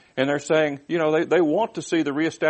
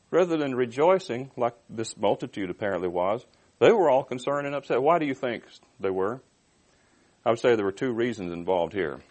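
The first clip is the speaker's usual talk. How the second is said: ordinary talk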